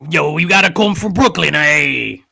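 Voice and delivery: loud Brooklyn tough-guy voice; brash New York street talk; Brooklyn accent. Retold or imitated. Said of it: "like, heavy Brooklyn accent".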